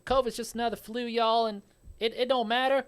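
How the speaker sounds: a smug Southern anti-vaxxer, played for laughs; southern accent. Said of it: "I'm gonna use my southern accent again".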